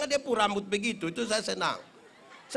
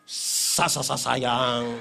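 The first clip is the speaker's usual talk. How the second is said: very slow, lower, halting sayang; breathy stuttering endearment; 8 semitones lower, 5 dB louder, much slower than usual. Retold or imitated. Wife savoring her husband's caress, halting and very slow. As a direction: melt into the word sayang syllable by syllable.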